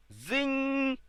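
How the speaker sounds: high ringing buzzer zing; buzzer sound. Pretend. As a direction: imitate a quiz show buzzer, pitched high. Imitating the game buzzer.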